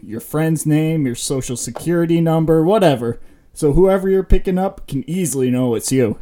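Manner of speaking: upbeat commercial announcer voice; ad read. Acted. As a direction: sell it like a late-night TV ad. Mock sponsor ad read.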